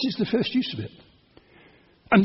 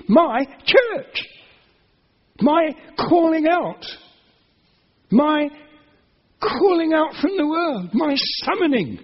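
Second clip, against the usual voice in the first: fervent preaching; 6 semitones higher, slower than usual. Real, not acted. Preacher to congregation, marvelling at Jesus' words, fervent and rising. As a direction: let the wonder swell as you repeat it.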